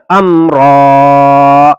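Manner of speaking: امرا with the final ا drawn out one alif long, very slow; teacher drawing out امرا slowly for the class; stretched ending. Shown as a demonstration. Teacher to pupils, demonstrating the lengthened ending, very slow. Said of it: "ایک الف کے برابر کھینچ کر".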